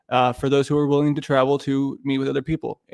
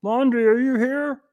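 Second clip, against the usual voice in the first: higher, slow put-on teacher voice calling roll; teacher taking roll; 9 semitones higher, slower than usual. English teacher calling roll in class, higher slow teacher voice. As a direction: read out the attendance list. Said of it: "teachers of English".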